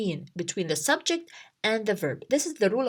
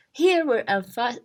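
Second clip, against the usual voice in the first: normal talking voice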